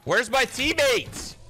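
loud slow shout. Real, not acted. Streamer yelling for his squad.

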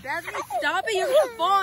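high urgent panicked plea; panicked scolding. Real, not acted. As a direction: stop her rocking in a panic. Scolding girl rocking the hammock.

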